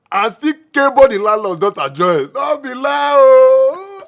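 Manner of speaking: loud, high shout of disbelief. Acted. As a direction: yell it out, amazed.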